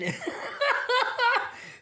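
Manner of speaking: very high squealing laugh. Real, not acted. Laughing at anthem joke.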